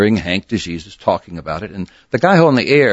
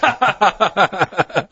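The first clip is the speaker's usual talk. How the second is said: hearty laugh; 11 semitones higher than usual. Real, not acted. Laughing at own story.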